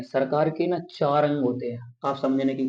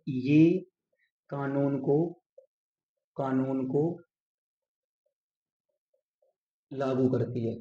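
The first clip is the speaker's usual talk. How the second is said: slow, with long pauses between phrases; phrase by phrase at writing speed; dictating while writing; slower than usual. Shown as a demonstration. Writing the sentence out while saying it. (लिखते हुए धीरे, ठहर-ठहर कर)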